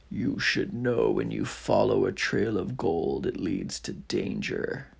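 deep dragon, sinking to a breath. Acted. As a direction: growl a warning as the dragon. Dragon voiced in story.

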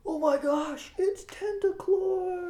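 high, loud, drawn-out fan cry; amazed crowd. Acted. Game master voices fans spotting the octopus beast, amazed high cry. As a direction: gasp it out high like an awestruck arena fan. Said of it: "all the people in the fa-, in the stands are going".

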